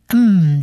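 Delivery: 嗯 as a sustained nasal "mmm"; nasal sound. Shown as a demonstration. Teacher shows the letter at word end, nasal hum. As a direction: hum 嗯 through the nose. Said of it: "我们念鼻音".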